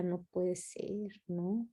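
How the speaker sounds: barely voiced, slowed right down in disbelief; hushed disbelief. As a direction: murmur it to yourself, slow and stunned. Oyendo una clase, yo decía. (incredulidad en voz muy baja y lenta)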